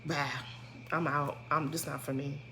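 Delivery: hushed, low, trailing off; quiet exit line. Shown as a demonstration. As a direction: let it drop away to almost nothing. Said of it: "why you didn't just".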